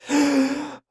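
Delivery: high exhaled sigh after a big glug; sigh after drinking. Acted. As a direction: let out a long sigh. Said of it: "takes a couple of big glugs".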